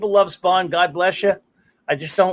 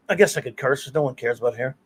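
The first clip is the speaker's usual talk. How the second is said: usual speaking voice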